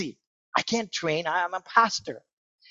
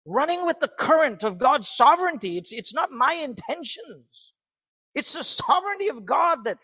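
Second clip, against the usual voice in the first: much higher, louder impassioned preaching; full-force preaching; 7 semitones higher, 3 dB louder than usual. Acted. Preacher telling his congregation he can't quit, impassioned. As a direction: preach it with rising urgency.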